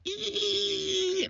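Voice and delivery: sharp high squeal like tires skidding; imitated tire screech. Son mimics the car screeching in, high squeal. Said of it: "our car just screeching into the parking lot".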